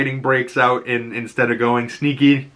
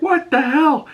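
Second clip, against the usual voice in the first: much higher, startled exclamation; shocked outburst. Retold or imitated. Achilles seeing Memnon's powered metal arm, high startled outburst. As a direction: blurt it out in shock.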